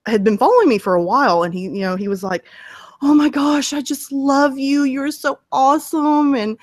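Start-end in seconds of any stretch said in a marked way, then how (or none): 3.0-6.6 imitated lovestruck fan, pitched up and drawn out; gushing fan. Subscriber proposing to the narrator, mock gushing. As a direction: gush like a smitten admirer, higher voice. Acting out a smitten subscriber's comment.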